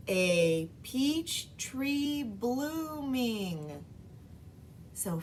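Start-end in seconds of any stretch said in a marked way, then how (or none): 0.1-3.8 very slow, "A peach tree blooming" syllable by syllable; syllables clapped. Shown as a demonstration. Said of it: "Blooming had two syllables".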